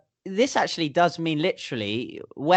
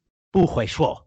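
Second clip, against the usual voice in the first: grumpy; 2 semitones higher than usual. Shown as a demonstration. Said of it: "That's if you're kind of angry or haven't had any breakfast".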